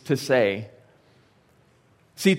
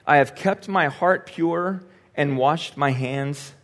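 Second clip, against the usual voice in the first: normal talking voice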